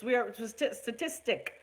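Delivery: "statistic" tripped over, restarted mid-word; stumbling over a word. Real, not acted. Host tripping over "statistic" on her live show. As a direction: fumble "stati-, statistic" and try again. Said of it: "I can't say it".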